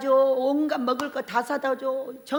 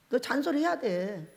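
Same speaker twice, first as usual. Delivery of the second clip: ordinary talk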